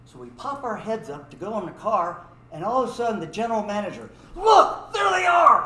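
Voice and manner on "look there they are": high, loud mock alarm; imitated manager's urgent high shout. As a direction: holler out a sudden sighting. Manager spots fleeing boys.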